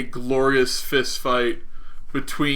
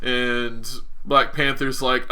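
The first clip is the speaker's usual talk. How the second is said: ordinary delivery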